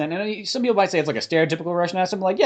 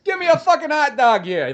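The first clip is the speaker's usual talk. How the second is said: loud, high, brash New York guy yelling; New York accent; 12 semitones higher, 5 dB louder than usual. Retold or imitated. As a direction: belt it like a New York street vendor. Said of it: "the most amount of accent you expect".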